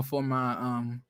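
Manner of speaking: usual speaking voice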